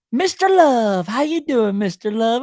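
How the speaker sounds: high, sweet, put-on greeting voice; imitating Ashley's greeting. Host retelling Ashley greeting him at Live Oak, high sweet imitation. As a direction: coo the greeting like a fond old friend. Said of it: "she goes".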